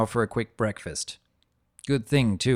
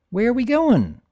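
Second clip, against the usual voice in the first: high, loud filly voice; curious filly; 13 semitones higher, 6 dB louder than usual. Acted. Filly riding on the dragon, high and curious. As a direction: ask it eagerly like a little girl. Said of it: "Bloomers asks".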